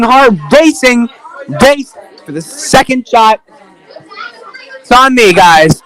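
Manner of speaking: energetic sportscaster call of a straw-boat race; live play-by-play. Acted. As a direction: race commentator calling the action.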